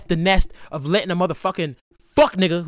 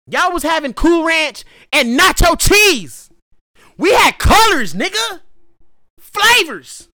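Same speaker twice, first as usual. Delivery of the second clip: higher, louder and slower, building to a peak; rising excited boast; hyped up; 10 semitones higher, 6 dB louder, slower than usual. Real, not acted. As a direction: brag it like the winner. Bragging about bringing Doritos.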